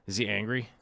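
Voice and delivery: ordinary delivery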